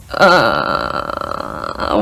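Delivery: long, low, creaky "uhh"; mortified low groan, acted out; embarrassed groan. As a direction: let out a soft, drawn-out groan of dread. Re-enacting her embarrassed reaction.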